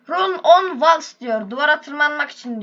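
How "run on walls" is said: mock English reading. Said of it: "Benim İngilizcem çok iyidir".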